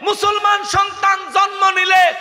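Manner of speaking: high, loud impassioned sermon shout; preaching at full force. Real, not acted. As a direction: drive it home at the top of your voice to the crowd. (জোর গলায় উত্তেজিত ওয়াজ)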